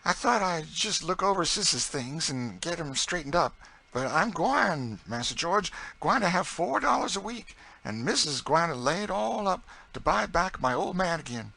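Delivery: high, loud dialect voice bursting with news; excited Southern cook. A narrator's reading. Narrator voices Chloe telling George.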